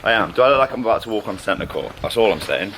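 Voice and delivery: ordinary delivery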